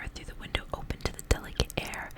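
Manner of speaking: plain reading voice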